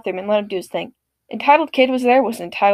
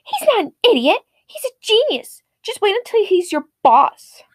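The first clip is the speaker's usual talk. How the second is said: indignant mom; 9 semitones higher than usual. Retold or imitated. Mom defends son indignantly.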